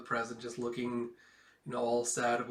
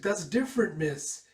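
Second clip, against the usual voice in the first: put-on high, loud, anguished girl's voice; despairing girl; 7 semitones higher, 5 dB louder than usual. Girl blaming herself for the deaths, high anguished voice. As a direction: pour out the guilt, close to tears.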